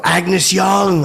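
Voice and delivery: loud, high star-struck holler; excited shout. Retold or imitated. Fan calls the rock star a second time, excited shout. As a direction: holler the name at your idol.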